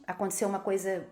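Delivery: ordinary delivery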